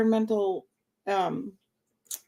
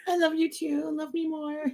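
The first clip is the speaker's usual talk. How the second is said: higher, softer pet voice; voicing the pet; 5 semitones higher, 4 dB quieter than usual. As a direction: answer as a needy little pet.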